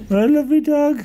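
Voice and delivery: high gushing coo; overwhelmed by cuteness. Real, not acted. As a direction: squeal over the cute puppy. Gushing over the puppy.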